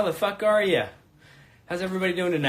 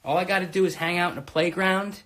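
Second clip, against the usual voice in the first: ordinary delivery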